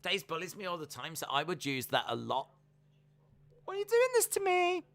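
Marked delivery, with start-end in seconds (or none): 3.6-4.8 very high squealed plea; Mandy Rose impression. Host mimicking a floored wrestler, squealed mock plea. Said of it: "just Mandy Rose on the floor going".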